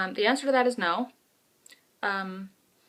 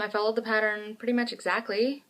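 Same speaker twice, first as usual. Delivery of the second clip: usual speaking voice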